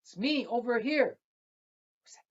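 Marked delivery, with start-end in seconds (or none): 0.0-1.2 much higher, louder small voice; a tiny voice piping up; little voice. Acted. Small girl answering the voice, much higher. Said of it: "in a little voice".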